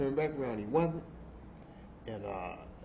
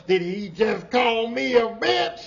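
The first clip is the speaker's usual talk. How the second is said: louder, slow indignant challenge; imitating the offended coworker, louder and slow; sassy indignant woman; 6 semitones higher, 8 dB louder, slower than usual. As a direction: drag it out with full attitude. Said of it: "That's how she was".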